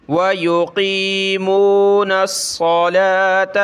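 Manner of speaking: slow, melodic Quranic recitation; teacher recites the complete verse; full recitation. Shown as a demonstration. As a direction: recite the whole verse with every rule. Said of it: "اس آیت کو ہم مکمل پڑھیں گے".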